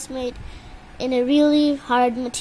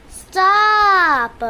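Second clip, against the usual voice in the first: loud stop; 5 semitones higher, 8 dB louder than usual. Real, not acted. Repeated, louder command.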